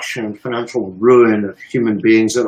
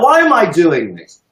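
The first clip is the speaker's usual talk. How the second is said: normal talking voice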